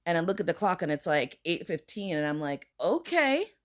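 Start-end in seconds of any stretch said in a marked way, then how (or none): 2.8-3.5 high okay. Retold or imitated. Hearing she only has until nine.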